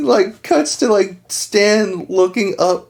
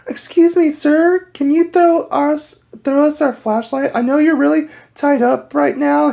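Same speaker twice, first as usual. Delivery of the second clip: lightly raised, sweetly polite plea; polite girl voice; 7 semitones higher than usual. Retold or imitated. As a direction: ask nicely, a bit higher, like a girl being polite. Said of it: "she's like".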